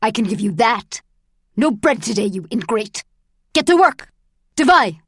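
loud guard's threat building to a shout; furious, contemptuous command; angry yelling. A narrator's reading. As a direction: bark orders, ending at full shout. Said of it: "yelled Ivanov".

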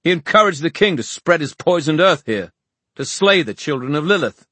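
high, loud indignation; spat accusation. A narrator's reading. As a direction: denounce him with bitter force. Mark's spat accusation continues.

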